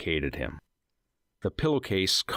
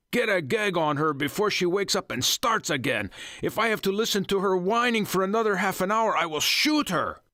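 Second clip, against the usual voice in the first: angry order; 11 semitones higher than usual. Acted. Ringleader ordering his men to gag her, angry. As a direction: bark the order in rage.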